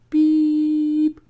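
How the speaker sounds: a high, steady flatline tone; mock flatline beep. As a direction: hold one high beep like a monitor. Imitating a flatline.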